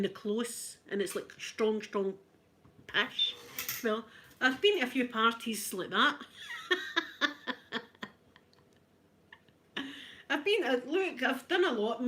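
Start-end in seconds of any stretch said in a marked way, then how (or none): 6.2-7.8 laugh. Real, not acted. Laughing after story.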